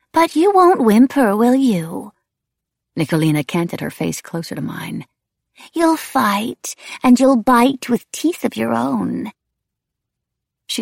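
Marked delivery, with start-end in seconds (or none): 0.1-2.1 very high, loud, eerie singsong; goading wraith. A narrator's reading. Wraith goads the narrator, high and taunting. 5.7-9.3 high, drawn-out eerie lilt; goading wraith. A narrator's reading. Wraith predicts the narrator will fight, eerie and goading.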